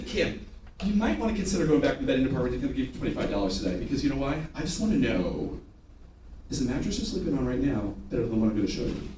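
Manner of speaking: low, quick, soft sales pitch; personal pitch to one guest. Shown as a demonstration. Salesman pitching Kim by name, personal and low-key. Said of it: "if I said your name and said that".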